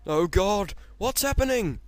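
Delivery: panicked shout. Acted. Henry crying out as he rolls away unbidden, panicked shout. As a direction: yell it as the engine starts rolling. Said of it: "he shouted".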